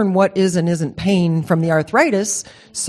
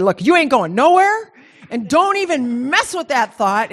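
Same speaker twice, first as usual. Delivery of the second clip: higher, emphatic telling-off; imitated no-nonsense warning, pitched up; firm retold scolding; 6 semitones higher than usual. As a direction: lay down the law to a husband.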